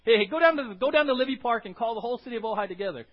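loud calling king giving an order; king's loud command. Retold or imitated. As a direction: call out like a king sending his herald. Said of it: "Someone that the king would say".

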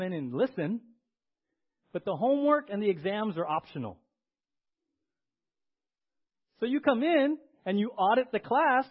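loud, high preaching build; full-force preaching. Acted. Pastor preaching loudly to the church about auditing. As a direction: drive it home to the congregation.

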